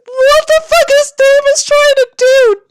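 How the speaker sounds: very high, loud, slow shrieking; frantic disbelief. Real, not acted. Reader watching Davis run onto the freeway, frantic high shriek. Panicked reaction to fic.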